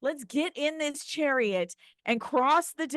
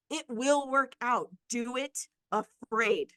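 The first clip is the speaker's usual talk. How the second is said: normal talking voice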